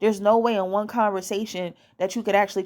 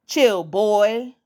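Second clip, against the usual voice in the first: put-on "boy" leaned on hard and louder; emphatic BOY; 5 dB louder than usual. Shown as a demonstration. Showing women how to call a man boy, emphatic "boy". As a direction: punch "boy" to belittle him. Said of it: "say it with so much emphasis".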